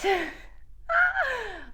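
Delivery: high, drawn-out cry of overwhelm; emotional squeal. Real, not acted. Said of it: "I don't know why I'm getting so".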